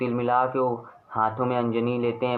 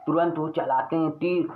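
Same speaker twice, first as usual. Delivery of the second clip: usual speaking voice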